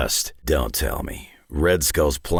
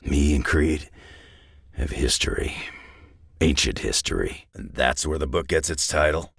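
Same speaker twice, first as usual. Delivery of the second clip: ordinary talk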